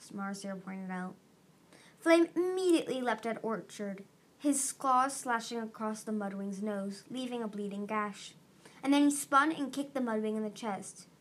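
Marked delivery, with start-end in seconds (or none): none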